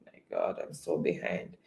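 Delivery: muttered aside. Real, not acted. Host flustered, muttering to self.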